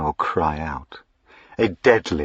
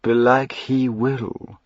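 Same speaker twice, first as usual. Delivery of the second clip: slow, easy, pleasant reply; airily pleasant villain; pleasant rejoinder; 5 semitones higher, slower than usual. A narrator's reading. Duke answers Diana pleasantly, unbothered. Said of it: "was the pleasant rejoinder".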